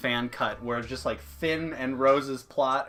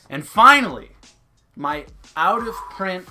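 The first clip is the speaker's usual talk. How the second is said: excited announcement; 5 semitones higher, 11 dB louder than usual. Real, not acted. Announcing the final pickup.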